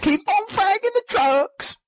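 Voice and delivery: high mock sign-off. Echoes sign-off.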